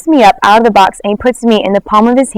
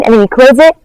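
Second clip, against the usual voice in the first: ordinary talk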